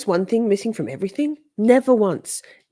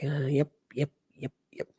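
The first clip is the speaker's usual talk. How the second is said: dismissive yep; 5 semitones lower, 8 dB quieter than usual. Retold or imitated. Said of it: "She's just like".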